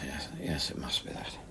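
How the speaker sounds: trailing hushed whisper. Acted. Chris deflecting Rhea's question, whispering. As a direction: let it fade to a whisper. Covering his fear, at night.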